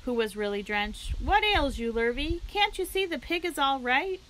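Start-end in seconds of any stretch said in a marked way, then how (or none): 1.2-4.2 bellowing. A narrator's reading. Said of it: "bellowed Mister Zuckerman".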